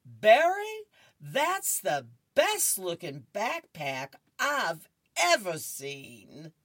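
a dad hamming up a compliment; gushing dad. Acted. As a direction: lay the fake enthusiasm on thick. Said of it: "Dad picks up Barry and said".

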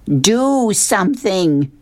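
slow, high-pitched plea; mock screamed demand. A narrator's reading. Fish demanding help from beauty seller, mock scream. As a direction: stretch each word into a mild screech. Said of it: "And the fish says".

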